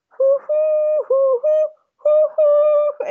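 high, loud hooted notes; imitated flute tooting. Fan imitating the Anchorman flute meme, high hooting. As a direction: hoot the tune like a flute. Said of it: "Anchorman one where he's playing".

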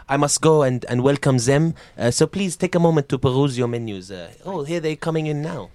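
courteous French maître d'; hesitant, polite French host; French waiter. Acted. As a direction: welcome guests in a French accent. Plays French restaurant host.

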